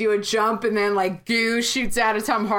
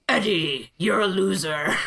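lower, lightly menacing alien taunt; mock Venom voice; 3 semitones lower than usual. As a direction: sneer it like the symbiote would. Venom insults Eddie, quoted.

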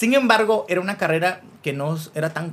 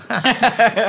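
loud, higher-pitched laugh; amused laugh; 5 semitones higher, 6 dB louder than usual. Real, not acted. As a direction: laugh at your own cheeky plug. Laughs after his plug joke.

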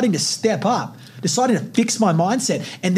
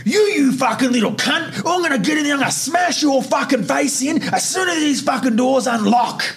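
furious shout, pitched well up; guard screaming threats at a new inmate, furious; retold guard's threat; 7 semitones higher than usual. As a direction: scream threats through the cell door. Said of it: "So loud".

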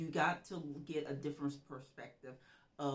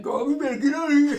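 loud, higher, laughing yell; mock urgent warning; 9 semitones higher, 16 dB louder than usual. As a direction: hustle your friend out in playful alarm. Acts urgent warning to coworker.

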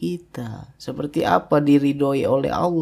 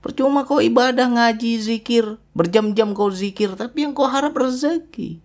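higher, stern reproach; rebuking divine voice; 8 semitones higher than usual. Retold or imitated. Preacher voices God rebuking a praying believer. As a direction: speak as God scolding the worshipper. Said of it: "kata Allah".

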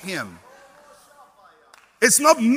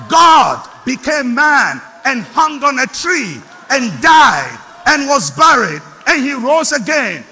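slowed and louder, each word weighted; slow preaching shout; 3 semitones higher, 8 dB louder than usual. Real, not acted. Preacher recounting Calvary, slow loud shout.

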